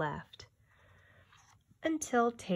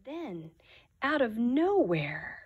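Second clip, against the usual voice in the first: put-on reading to children. A narrator's reading. Teacher reading a picture book aloud to the class, hushed. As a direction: draw the children into the story.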